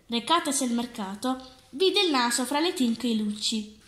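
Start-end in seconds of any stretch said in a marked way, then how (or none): none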